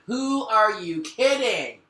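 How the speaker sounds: loud, slow, exasperated scolding; frustrated. Real, not acted. Reader scolds the masculine, frustrated and exasperated. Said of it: "I'm getting frustrated".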